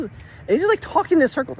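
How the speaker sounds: exasperated burst about Jeff's runaround. Real, not acted. Venting to listeners about Jeff, exasperated and high. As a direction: voice jumps up high in real exasperation. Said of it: "I'm just getting so pissed".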